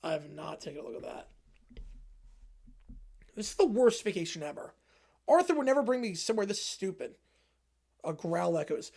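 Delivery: ordinary talk, nothing marked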